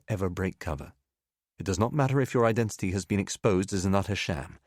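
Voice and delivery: plain reading voice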